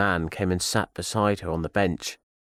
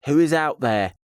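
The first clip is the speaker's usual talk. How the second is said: louder, higher call into the dark; shouted challenge; 7 semitones higher, 5 dB louder than usual. A narrator's reading. Scared woman calling to whoever is round the corner, shouting. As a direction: call out sharply to an unseen intruder. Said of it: "she shouted".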